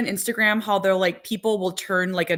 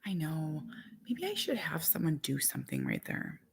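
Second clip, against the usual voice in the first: breathy aside whispered to herself; 4 semitones lower, 13 dB quieter than usual. Real, not acted. Mom whispering her mudroom idea to herself. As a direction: whisper it like a private thought.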